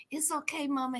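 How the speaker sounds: very soft, low reassurance; calm her down almost under your breath; hushed, low-pitched imitation of a soothing guy; soothing homeboy. Voices homeboy soothing mama.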